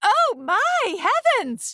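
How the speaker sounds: high, slow gasp of horror; a mother crying out in alarm; shocked cry. A narrator's reading. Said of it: "she cried".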